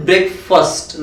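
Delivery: breakfast ending in "fəst", very slow; correct pronunciation. Shown as a demonstration. Teacher giving students the right breakfast, slowly. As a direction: say breakfast with a reduced second vowel. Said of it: "not fast, it is first".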